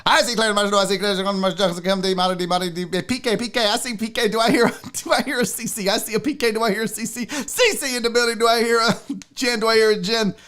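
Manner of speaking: fast auctioneer patter. Pretend. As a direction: rattle it off like an auctioneer. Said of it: "talking like an auctioneer".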